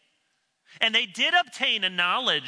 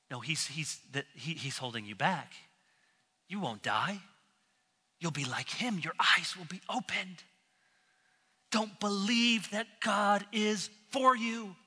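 hushed tempter Satan; 5 dB quieter than usual. Retold or imitated. Satan reinforcing doubt in Eve, hushed and coaxing. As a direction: lean in and plant doubt quietly. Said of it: "now Satan comes and reinforces this".

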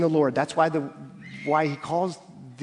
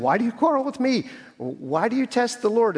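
indignant rebuke; 9 semitones higher than usual. Acted. Moses rebuking the quarreling people, higher and indignant. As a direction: demand it, stung by their accusation.

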